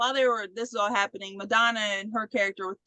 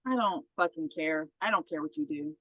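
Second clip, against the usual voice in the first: low, softer grumble of not caring; lightly voiced drunk, pitched down; drunk Jimmy; 8 semitones lower, 5 dB quieter than usual. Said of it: "the drunk".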